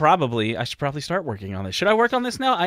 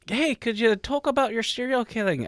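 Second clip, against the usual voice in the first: put-on a half-hearted, mostly failed stab at an Irish accent, pitched higher; terrible Irish accent; 9 semitones higher than usual. Cohost mimics Rachel's request in a bad Irish accent. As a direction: toss off a weak Irish-ish lilt as a joke. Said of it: "that was a terrible accent".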